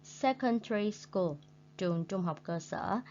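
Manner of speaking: ordinary talk, nothing marked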